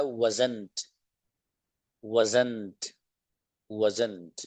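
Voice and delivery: wasn't said as one word, "wuzzn't"; contracted form. Shown as a demonstration. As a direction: run was and not together into wasn't. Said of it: "was not को पढ़ा है".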